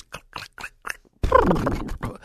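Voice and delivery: clicking horse-hoof noises with the mouth; imitated horse clanging. Preacher sets the scene of a busy road, imitated horse clatter. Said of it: "The clanging of the horses".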